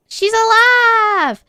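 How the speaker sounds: high, loud and slow relief; overjoyed cry. Acted. Tracy cheering that Mom is alive, high and loud. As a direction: cry out in joy.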